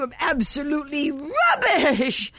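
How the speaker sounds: normal talking voice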